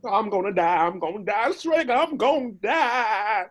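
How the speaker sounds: near tears, sure you'll die; whimpering terror. Acted. Donkey terrified on the bridge.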